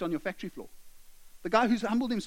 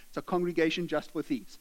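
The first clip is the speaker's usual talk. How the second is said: normal talking voice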